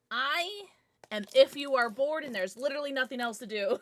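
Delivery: usual speaking voice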